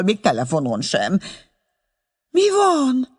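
high, fussing mother calling down the line; anxious joy, then scolding, pitched high; excited old mother on phone. Acted. (izgatott, aggódó anya telefonhangja)

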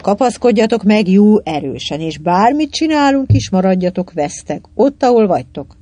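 usual speaking voice

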